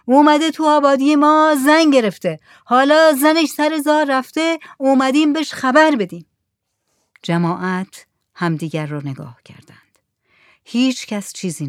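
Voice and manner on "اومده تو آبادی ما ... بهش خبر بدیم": high, loud shouted explanation; calling from the boat. A narrator's reading. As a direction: holler the news across the water. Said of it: "مردی که روی سینهٔ جهاز". (خبر دادن با فریاد از دور)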